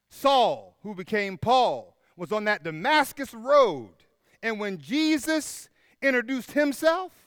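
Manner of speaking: loud, high, rising preacher's cry; full-force preaching. Acted. Pastor to his church, preaching at full force. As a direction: preach it out over the congregation.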